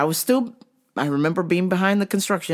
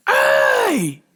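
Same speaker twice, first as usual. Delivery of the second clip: furious road rage yell; slower than usual. Retold or imitated. Construction guy yelling at the FedEx driver, furious shout. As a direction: scream at the driver like you want a fight. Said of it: "he was like".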